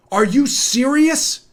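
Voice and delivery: high, drawn-out disbelief; incredulous. Real, not acted. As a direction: stretch the question upward in disbelief. Incredulous reaction to the quote.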